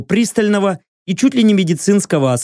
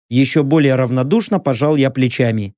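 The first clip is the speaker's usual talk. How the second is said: normal talking voice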